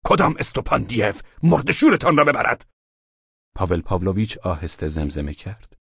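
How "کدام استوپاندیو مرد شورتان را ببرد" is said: angry shout. Acted. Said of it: "پایش را به زمین کوبید و فریاد کشید".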